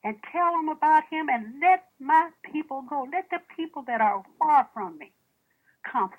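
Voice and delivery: loud, high preaching cry; preaching at full force. Real, not acted. Preacher rousing the congregation, loud and high. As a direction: lift it like a pulpit call.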